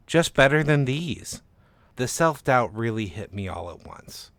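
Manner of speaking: plain reading voice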